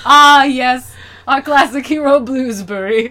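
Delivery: high, loud British connoisseur; hammed-up British accent introducing an old hero; put-on British, grandly delighted; mock British. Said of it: "He's British and he's blue".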